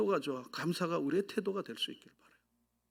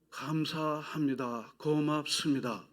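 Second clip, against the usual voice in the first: slow, drawn-out 감사합니다 and 고맙습니다; slow thanks; 3 dB quieter, much slower than usual. Shown as a demonstration. Preacher modelling thanks for the church, slow and drawn out. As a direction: stretch each syllable of 감사합니다 gently. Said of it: "계속해서".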